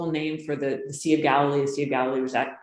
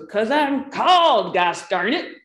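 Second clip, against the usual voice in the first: higher, louder, defiant blurt with a drawn-out called; emphatic retort; 6 semitones higher, 5 dB louder than usual. Retold or imitated. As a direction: fire back proudly at the other pastor. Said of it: "And he goes".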